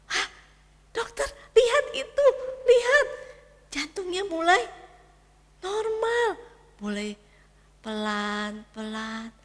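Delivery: put-on high, loud teary child's cry; a boy calling the doctor through tears; crying little boy. Anak menangis melihat monitor. (anak kecil menangis memanggil dokter)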